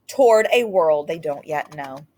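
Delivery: ordinary delivery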